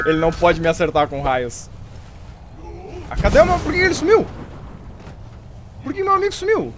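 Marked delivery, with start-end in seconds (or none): 3.1-6.7 higher surprised whine. Real, not acted. Summoned ally vanished.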